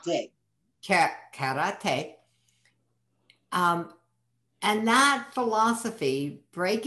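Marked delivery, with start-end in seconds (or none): 0.9-2.1 "Ka-, karate" slow, halting, first syllable tried alone; repeating karate slowly. Shown as a demonstration. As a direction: sound out "Ka-, karate" carefully. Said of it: "slowing it down".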